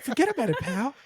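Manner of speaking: high-pitched mock tough-guy accent; Mark Wahlberg. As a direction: tease with an exaggerated celebrity impersonation. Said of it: "sound like Mark Wahlberg".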